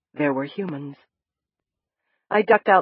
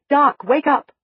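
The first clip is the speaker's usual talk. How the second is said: calling out; 9 semitones higher, 7 dB louder, slower than usual. A narrator's reading. Narrator calling over her shoulder to sleeping Doc, raised call.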